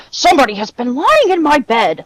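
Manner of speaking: emphatic storybook bear voice; gruff Father Bear. A narrator's reading. Father Bear finds his bed slept in, gruff voice. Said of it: "in his great, gruff voice".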